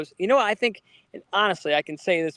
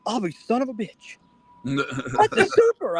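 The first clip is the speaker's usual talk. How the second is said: amazed uncle; 6 semitones higher, slower than usual. Retold or imitated. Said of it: "He goes".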